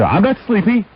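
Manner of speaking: high squeaky mock of the lyric; high mock quote. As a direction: squeak it out like a parody. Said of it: "She said".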